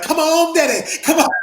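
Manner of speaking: loud, high hyped-up Dusty impression; imitated excited cheering. Dusty cheers on the struggling bench presser, excited. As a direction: urge him on, voice jumping way up. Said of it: "Dusty's just jumping up and down".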